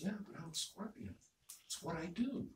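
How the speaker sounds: lightly voiced scorpion, quietly owning up; matter-of-fact scorpion. Scorpion explaining itself to the dying frog, resigned.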